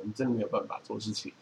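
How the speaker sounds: usual speaking voice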